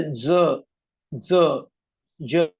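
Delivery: ज sounded out singly, Marathi way; Marathi ज sounds. Shown as a demonstration. Teacher showing Marathi letters, ज pronounced in isolation. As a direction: demonstrate each Marathi ज separately. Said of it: "Marathi के बारे में बनाया".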